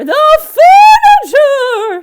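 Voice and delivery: very high, very loud, slow wail; cried out. A narrator's reading. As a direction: shriek in horror. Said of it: "she cried".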